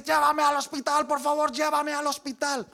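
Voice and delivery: high, loud desperate begging; pleading in pain. Retold or imitated. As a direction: beg to be taken to hospital. (súplica desesperada, aguda y fuerte)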